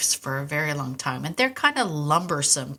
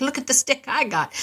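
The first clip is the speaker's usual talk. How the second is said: proud mimic; 8 semitones higher than usual. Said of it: "be like".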